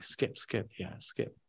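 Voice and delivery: whispered name drill under his breath; whispered to himself. Retold or imitated. Man memorizing the pilot's name, whispering it to himself. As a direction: whisper it over to lock it in.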